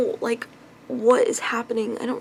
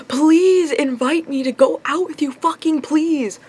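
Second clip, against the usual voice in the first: slow, louder, higher begging; exasperated plea; 6 semitones higher, 5 dB louder, slower than usual. Retold or imitated. Vlogger mocking her own plea to her crush, exasperated begging. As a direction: beg him like you're at your wits' end.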